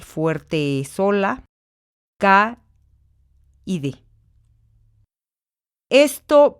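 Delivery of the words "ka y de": "ka" and "de" said apart, with a pause between; split into syllables. Shown as a demonstration. Teacher splitting decae into syllables. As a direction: sound out "ka y de" one at a time. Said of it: "la separación silábica tendrá que ser".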